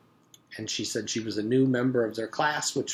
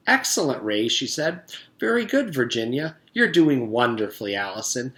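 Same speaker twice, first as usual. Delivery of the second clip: teacher praise; 3 semitones higher, 7 dB louder than usual. Acted.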